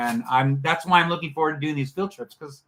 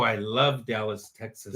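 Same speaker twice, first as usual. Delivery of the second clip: I love Dallas, Texas, said slowly with every word drawn out and a low finish; 9 semitones lower, 4 dB quieter, slower than usual. Shown as a demonstration. Co-host savoring his love for Dallas, drawn out slowly. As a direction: linger lovingly over "love" and "Dallas". Repeats line to play on it.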